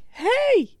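put-on very high, loud kid shout; kid yelling. Kid calls after the leaving delivery man, high shout. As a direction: yell it like a bratty kid.

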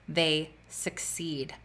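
slow whisper trailing off; whispered verse ending. Real, not acted. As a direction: breathe the last words out, slowly.

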